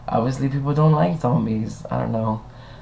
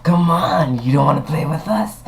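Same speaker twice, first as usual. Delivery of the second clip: creepy zombie taunt; 3 semitones higher than usual. Pretend.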